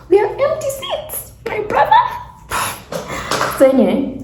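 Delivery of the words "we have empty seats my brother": very high, squeaky incredulous voice; mock protest. Storyteller to man who sat across, high mock protest.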